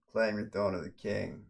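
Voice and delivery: usual speaking voice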